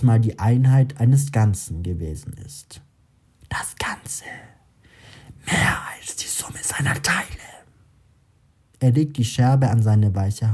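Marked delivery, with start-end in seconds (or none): 3.5-7.3 whispered voice inside his head; whispery telepathic voice. A narrator's reading. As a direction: breathe it like a voice in his mind. Inner voice speaks.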